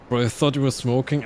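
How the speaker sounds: ordinary delivery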